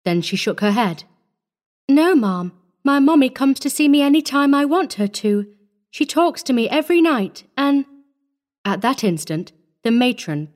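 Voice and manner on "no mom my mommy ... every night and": put-on high, earnest small child; little girl's voice. A narrator's reading. Orphan telling the lady about her mother, high child's voice. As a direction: answer as a small child, pitched up.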